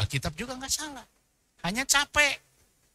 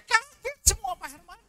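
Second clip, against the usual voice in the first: high, startled "Kaget semua"; mock astonishment; 13 semitones higher than usual. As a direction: gasp the words in hammed-up shock. Disciples startled at ascension.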